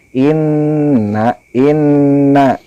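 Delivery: "inna" with a held, nasal doubled n. Shown as a demonstration. Teacher shows pupils the stressed nun, inna. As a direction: hum the nn of "inna" with gunnah. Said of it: "nun bertasydidnya ditekan".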